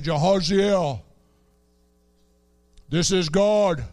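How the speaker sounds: hammed-up grand divine voice; mock voice of God. Preacher mocking a theatrical God voice to Jehaziel, hammed-up. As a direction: play God as a showy announcer, lightly. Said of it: "it wasn't this deep voice where God said".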